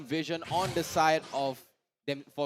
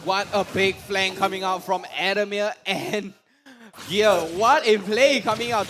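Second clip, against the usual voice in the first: excited caster; 3 semitones higher, 9 dB louder than usual. Real, not acted. Caster hype call.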